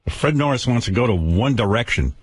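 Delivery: normal talking voice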